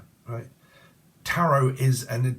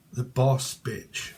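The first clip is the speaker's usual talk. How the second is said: ordinary delivery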